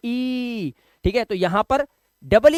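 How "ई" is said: ई held long and steady; drawn-out ई vowel. Shown as a demonstration. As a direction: stretch ई out as a sustained sound. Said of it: "ये आवाज आती है".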